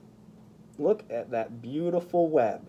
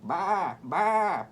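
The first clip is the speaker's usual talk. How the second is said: bleating sheep; 4 semitones higher than usual. Acted. Voicing the sheep in the story, bleating baa. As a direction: bleat it like a lamb. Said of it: "bleated the sheep".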